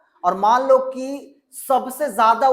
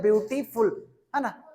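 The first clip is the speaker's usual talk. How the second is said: Beautiful with the syllables closer together; teacher demonstrating a quicker reading of beautiful; read more quickly; 4 dB quieter than usual. Shown as a demonstration. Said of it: "जल्दी पढ़ते हैं तो पढ़ते हैं".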